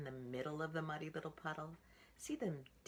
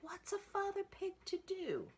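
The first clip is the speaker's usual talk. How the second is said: much higher, fretting father pig voice; a puzzled father pig, pitched way up; father pig asking himself what to do, in a high fretting voice; 4 dB louder than usual. Acted. Father pig wonders aloud.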